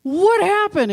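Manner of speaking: high, loud mock-astonished question; older brother. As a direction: imitate an alarmed brother wanting the news. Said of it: "his older brother says to him".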